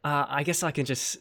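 London British accent. Shown as a demonstration. Improvising a British impression for a friend, in a London accent. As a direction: keep chatting in an exaggerated London accent. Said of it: "very London".

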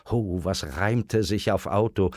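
plain reading voice

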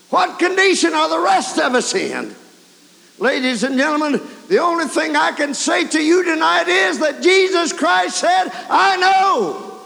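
high, loud revival preaching; fiery preaching. Acted. Preacher presses the congregation in a loud, high sermon climax. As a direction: drive it home to the congregation.

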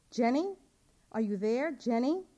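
searching mother calling for her daughter, loud. Acted. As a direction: call up into the empty hallway. Calling into empty hallway.

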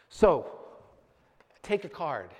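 ordinary talk

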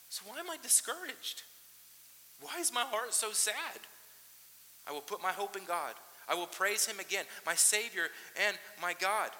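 high, lifted lament slowing into resolve; pleading psalm reading. Acted. Pastor reading the psalmist's lament, pleading then resolved. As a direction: ask why, then settle into hope.

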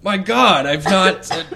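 loud, high minotaur voice; Gary. Acted. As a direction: exclaim as a hearty great beast meeting a friend. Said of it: "Gary the Minotaur".

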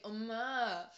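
loud, whiny, affectionate teasing; teasing whine. Retold or imitated. Daughter teasing her mom in Korean, playful whine. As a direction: whine it as endearing, loving teasing. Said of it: "make these whining sounds in Korean".

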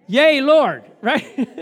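loud, high-pitched declaration; emphatic affirmation. Acted. Preacher answering his own question, loud and emphatic. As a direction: belt it out as a confident answer. Congregation prompted with the men's answer.

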